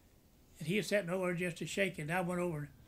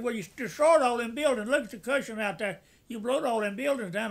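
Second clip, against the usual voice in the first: a raised, higher scolding colonel; retold scolding; 3 semitones higher, 6 dB louder than usual. Colonel reprimanding the private for destroying buildings, raised scolding. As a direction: chew him out for the damage.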